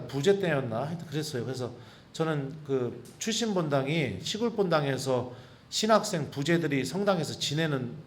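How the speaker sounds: ordinary talk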